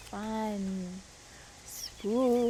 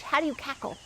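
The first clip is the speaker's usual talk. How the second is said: plain reading voice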